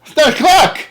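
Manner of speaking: high, loud disbelief; can't believe it's too late already; incredulous outburst. Retold or imitated. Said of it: "And I said".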